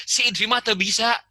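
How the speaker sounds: high, lively teasing friend's voice; friends teasing in Sundanese. Retold or imitated. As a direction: poke fun at him like an old friend. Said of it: "teman-teman saya tahu".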